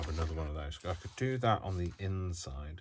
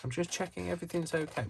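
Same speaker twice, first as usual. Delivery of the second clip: mock chirpy nurse; 12 semitones higher than usual. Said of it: "somebody comes over and says".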